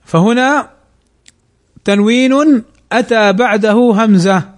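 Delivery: ordinary talk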